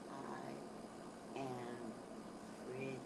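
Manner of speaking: low, hushed affirmation; soft self-affirmation. Shown as a demonstration. Speaker showing what to say on waking, low and hushed. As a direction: murmur it low, as if to yourself.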